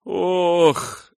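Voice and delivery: sharp high pained groan; a sudden cry of pain. A narrator's reading. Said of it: "застонал Томми".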